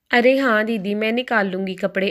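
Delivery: plain reading voice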